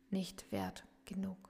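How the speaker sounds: sinking into a soft whisper. Acted. Coach naming the listener's self-doubt, whispered. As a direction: let the words fade to a whisper.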